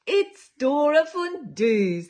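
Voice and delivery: usual speaking voice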